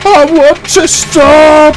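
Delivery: high, slow terrified wail. Acted. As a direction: wail it out in panic. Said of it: "he wailed".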